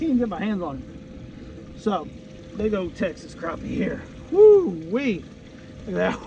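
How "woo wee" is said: excited whoop. Real, not acted. Admiring a big crappie.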